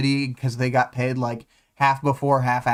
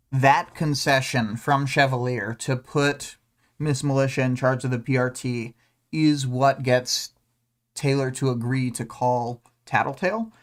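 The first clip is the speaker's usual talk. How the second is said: ordinary talk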